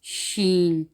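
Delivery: शीन with a drawn-out "sh" start; sheen sound. Shown as a demonstration. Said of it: "आवाज़ हमेशा शीन की आएगी".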